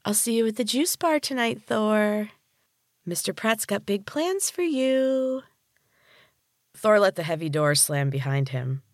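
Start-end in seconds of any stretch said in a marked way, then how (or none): 0.0-5.4 high sing-song voice. Acted. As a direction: tease him in a lilting high voice. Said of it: "called after him in a high, sing-song voice".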